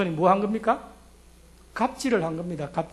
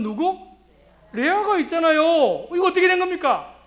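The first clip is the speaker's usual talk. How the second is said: indignant protest; 5 semitones higher than usual. Acted. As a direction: protest hotly at the swapped bride. Jacob confronting uncle after wedding.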